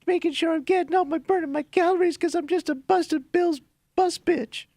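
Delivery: high, whiny mocking voice; whiny bitch voice. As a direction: sneer it out in a whiny tone. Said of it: "We take all your bitch voices".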